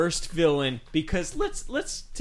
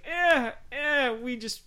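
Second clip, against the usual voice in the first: high-pitched, shrugging "eh"; dismissive mock excuse; 10 semitones higher, slower than usual. Film fan mimicking the filmmakers' excuse, dismissively. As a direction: toss it off as a lame excuse.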